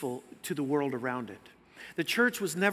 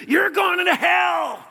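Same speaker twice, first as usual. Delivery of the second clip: drawn-out, high, full-throated street preacher's shout; bullhorn scream; 12 semitones higher, 10 dB louder, much slower than usual. Retold or imitated. Street preacher at a driver three feet away, screaming. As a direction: scream it slowly at passers-by, high and loud. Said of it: "he screams into the bullhorn".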